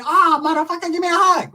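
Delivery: high put-on voice, delighted; excited gangster greeting. Shorty recognizing his old friend, high caricature welcome.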